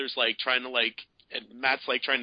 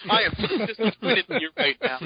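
higher, lightly mocking scold; a hint of a prim disappointed girlfriend; Betty Brant style; 8 semitones higher than usual. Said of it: "Betty Brant style".

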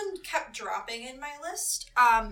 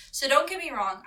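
normal talking voice

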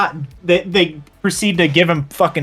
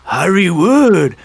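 slow, drawn-out and higher, lightly put-on voice; mock Japanese officer; 6 semitones higher, 5 dB louder, slower than usual. Friend retelling the periscope gag, higher drawn-out mock voice. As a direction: stretch it out, pointing in delight. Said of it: "he points at it and he goes".

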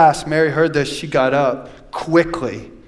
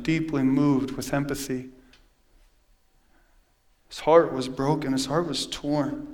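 tender and hushed; 3 semitones lower, 6 dB quieter than usual. Acted. Pastor softly telling the church how Jesus mourned. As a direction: bring it down gently, grieving with them. Preacher turns soft on grief.

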